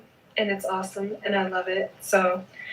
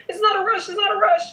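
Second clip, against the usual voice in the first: sassy insistence; 11 semitones higher, 3 dB louder, much faster than usual. Acted.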